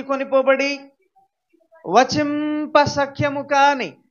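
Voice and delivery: slow, solemn Bible reading; scripture reading. Acted. Pastor reading the verse to the congregation, slow and solemn. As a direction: read the verse slowly and gravely.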